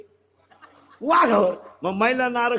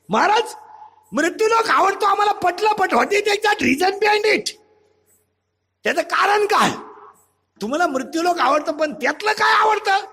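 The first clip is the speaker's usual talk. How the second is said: high, ringing voice pressing for an answer; insistent questioner; 8 semitones higher than usual. Acted. Devotee pressing Maharaj on why he likes this world, high and insistent. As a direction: demand the reason in a raised pitch.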